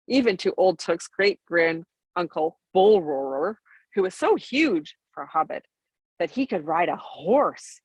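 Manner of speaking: ordinary delivery, nothing marked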